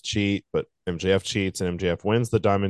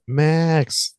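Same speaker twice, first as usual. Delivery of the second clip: mild mock yell, raised pitch; retold yell; 6 semitones higher, 3 dB louder than usual. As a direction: imitate a yell without full volume. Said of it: "comes out and yells".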